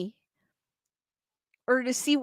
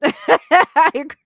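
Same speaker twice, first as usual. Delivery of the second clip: laughing; 3 semitones higher, 9 dB louder, much slower than usual. Real, not acted. Laughing at unplug comment.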